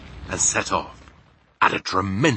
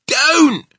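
sharp, high, loud plea; pained cry; 13 dB louder than usual. A narrator's reading. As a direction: cry out as if it hurts. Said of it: "cried the poor B F G".

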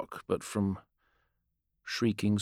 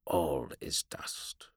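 slow, hollow unvoiced whisper; 6 semitones lower, 7 dB quieter, much slower than usual. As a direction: breathe it out like dust. Undead warrior speaks hollowly.

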